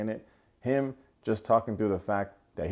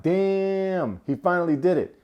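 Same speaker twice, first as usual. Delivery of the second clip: impressed; 8 semitones higher, 3 dB louder, slower than usual. Retold or imitated. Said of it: "And I'm like".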